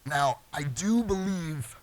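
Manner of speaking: believe drawn out slowly and leaned on; a slow, weighty "believe"; stress on believe. Shown as a demonstration. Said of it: "emphasis on believe".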